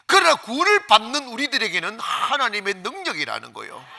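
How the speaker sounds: loud, high, driving pulpit voice; ringing, insistent sermon climax; full-force preaching. Real, not acted. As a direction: proclaim it to the whole congregation.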